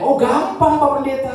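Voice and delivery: put-on high, bright old-lady voice, pleased and carefree; grandma's voice. Old grandma cheerfully answering the pastor, high-pitched. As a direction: play the grandma answering without a worry. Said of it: "Lalu omanya".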